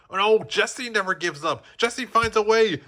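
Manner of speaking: high, bright declaration of the motto; confident declaration. A narrator's reading. Jessie finally understanding and declaring her motto. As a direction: declare it with new resolve. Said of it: "the cowgirl declared".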